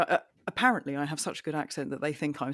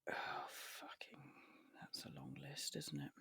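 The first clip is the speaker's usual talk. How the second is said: whisper to self; 22 dB quieter than usual. Real, not acted. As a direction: mutter under breath at the screen. Reading translate results.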